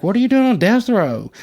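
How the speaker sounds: can't believe he's here; shocked question. Retold or imitated. Shocked question to old friend.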